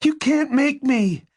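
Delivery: very high-pitched defiant cry; desperate defiance. A narrator's reading. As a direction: defy him, voice pushed way up. Crying Angie defies Rob.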